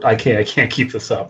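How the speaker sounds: ordinary delivery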